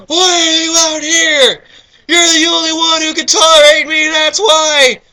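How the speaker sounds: very high, loud, slow whine; plaintive figure. Acted. Reader voicing the lonely stranger, high and whining. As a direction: wail it like a lonely outcast.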